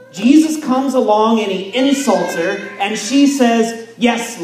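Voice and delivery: loud, driving preacher voice; impassioned preaching. Acted. Pastor pressing faith on the congregation, in a loud impassioned preach. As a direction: hammer it home with conviction.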